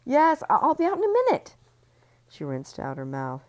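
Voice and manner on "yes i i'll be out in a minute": high, loud, fast answer; flustered call. Acted. Ellie flustered answering through the door, high and fast. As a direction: rush a cover-up reply.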